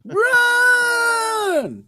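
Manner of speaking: long, very high yelled warning; mock shout. Co-host urging the reader to flee the bearded man, mock shout. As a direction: holler it out, stretched and playful.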